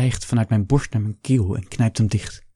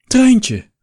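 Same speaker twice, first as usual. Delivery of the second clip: high, loud startled cry; a shout that breaks with surprise; voice cracking in shock; 14 semitones higher, 9 dB louder than usual. A narrator's reading. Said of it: "Zijn stem slaat over". (de oude schilder schrikt en roept met overslaande stem)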